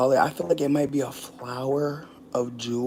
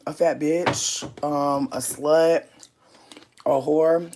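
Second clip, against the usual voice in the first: ordinary delivery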